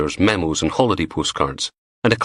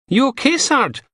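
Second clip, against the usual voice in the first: higher female voice. A narrator's reading. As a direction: read Butler higher and lighter. Female character calls to Logan.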